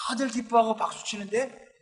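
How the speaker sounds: usual speaking voice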